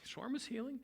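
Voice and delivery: high-pitched mock puzzlement; puzzled audience. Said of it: "you're all looking at the word shawarma going".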